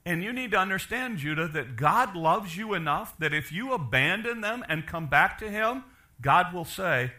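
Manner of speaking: a preacher pleading with Judah to come back, impassioned; impassioned preaching. Acted. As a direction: urge them back like a preacher begging. Pastor pleads God's call.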